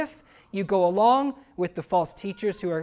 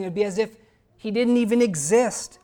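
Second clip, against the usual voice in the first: fervent preaching; 2 semitones higher, 4 dB louder than usual. Real, not acted. Preaching at full force.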